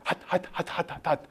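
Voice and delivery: breathy unvoiced panting of hot; airy whispered hots. Shown as a demonstration. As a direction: puff it out like a fever. Miming feverish heat.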